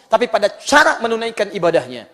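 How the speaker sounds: ordinary talk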